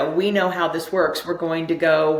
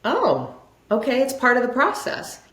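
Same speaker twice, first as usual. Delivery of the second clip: higher-pitched, light acceptance; lifted, untroubled "oh, I get it"; acted realization; 7 semitones higher than usual. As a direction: voice a sudden easy realization. Acts out a calm realization.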